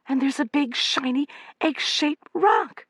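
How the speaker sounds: high, excited, stretching out the last words; excited cry. A narrator's reading. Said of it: "cried Amanda".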